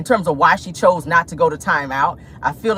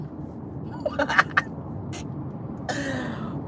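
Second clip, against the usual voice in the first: long, quiet, breathy cackle; wheezy laugh that trails on; 6 semitones higher, 11 dB quieter than usual. Real, not acted. Host tickled by own joke, breathy cackle.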